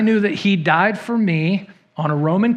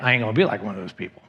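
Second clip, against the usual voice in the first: dismissive; 7 semitones lower, 5 dB quieter, much faster than usual. Retold or imitated. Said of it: "some of you are like".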